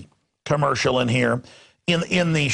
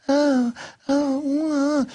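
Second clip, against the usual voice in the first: high, sighing "oh, oh"; breathy flirty woman; 11 semitones higher, much slower than usual. Retold or imitated. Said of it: "when she's".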